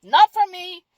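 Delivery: loud, sing-song brush-off of negative people; firm refusal. Retold or imitated. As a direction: shoo the negativity away cheerfully. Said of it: "And I'm like".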